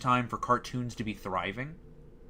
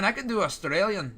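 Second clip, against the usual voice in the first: higher-pitched botched Australian accent, landing in Scottish; Australian accent slipping Scottish; 7 semitones higher than usual. Shown as a demonstration. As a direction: attempt Australian but drift into Scottish. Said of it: "No, that's Scottish".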